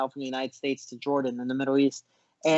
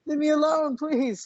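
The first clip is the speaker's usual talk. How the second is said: much higher and louder, fed-up "leave me alone"; exasperated plea; 11 semitones higher, 4 dB louder, slower than usual. Retold or imitated. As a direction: beg him to back off, at the end of your rope. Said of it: "I'm like".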